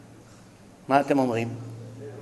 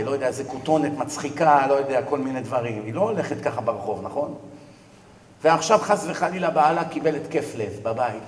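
ordinary talk

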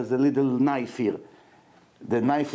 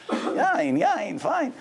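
mocking yain; 10 semitones higher, much slower than usual.

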